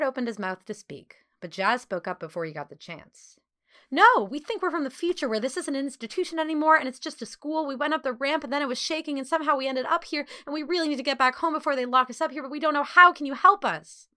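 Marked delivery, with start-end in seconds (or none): 3.9-14.1 high, rushed torrent of words; very fast talking. A narrator's reading. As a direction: blurt it all out without a break, urgently. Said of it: "She spoke so quickly".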